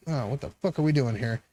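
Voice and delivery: ordinary delivery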